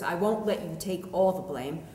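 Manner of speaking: ordinary delivery, nothing marked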